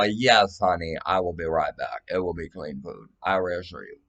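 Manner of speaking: low, soft promise to a honey; soothing reassurance. Acted. Reassuring honey about coming right back, low calm reassurance. Reassuring a partner in imagined exchange.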